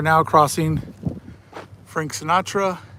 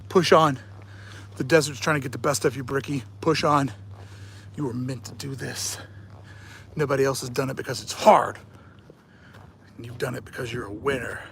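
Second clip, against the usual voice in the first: quiet self pep talk; 5 semitones lower, 8 dB quieter than usual. Pretend.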